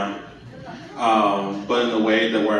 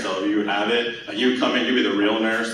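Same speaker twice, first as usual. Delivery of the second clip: usual speaking voice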